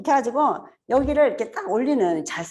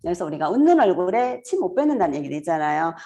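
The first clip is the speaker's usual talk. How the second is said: ordinary talk, nothing marked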